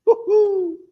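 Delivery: high, loud woo-hoo cheer; joyful whoop. Real, not acted. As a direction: let out a happy cheer. Cheering while cooking.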